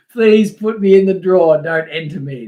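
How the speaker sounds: reading the chat comment out loud and hammed; loud mock reading. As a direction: boom the viewer's line out, mock-pleading. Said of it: "horrible sounding".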